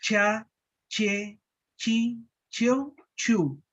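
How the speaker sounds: Cha, che, chi, cho, chu with a hard, forceful ch; strong ch syllables. Shown as a demonstration. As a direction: drill each syllable crisply, one by one. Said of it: "Esa es en la forma fuerte". (sílabas con ch fuerte)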